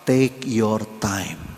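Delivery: very slow, gently spaced take your time; slow advice. Retold or imitated. Preacher advising the widow, slow and calm. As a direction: lay each word down slowly.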